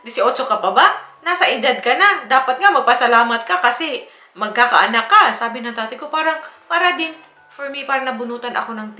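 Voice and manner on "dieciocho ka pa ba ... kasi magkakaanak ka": father lecturing. Retold or imitated. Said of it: "Sabi, parang".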